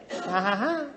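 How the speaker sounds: dry, mocking fake laugh. Parent answering a child's plea to go now, mock laugh. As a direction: scoff with a put-on ha-ha-ha.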